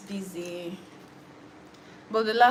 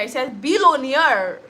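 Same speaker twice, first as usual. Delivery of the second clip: billionaire drawn out and loud; emphatic billionaire, stretched out; stress on billionaire; 5 semitones higher, 8 dB louder, slower than usual. Real, not acted. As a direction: lean hard on the word billionaire, higher and slower. Correcting a misreading of millionaire.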